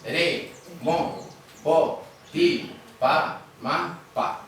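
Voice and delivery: syllable by syllable, pause after each; sillabato. Shown as a demonstration. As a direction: count out "Re, mo, po, ti, pa, ma, pa" as separate beats. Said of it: "¿Cuántas sílabas tiene?".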